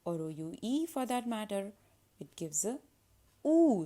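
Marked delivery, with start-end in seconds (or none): 3.5-3.9 "oo" held long, high and loud; stretched oo. Shown as a demonstration. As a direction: stretch out the "oo" vowel. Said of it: "stretched sound".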